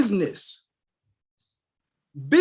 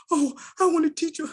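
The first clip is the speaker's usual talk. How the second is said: higher, sweet thanks from a parent; grateful mother; 8 semitones higher than usual. Retold or imitated.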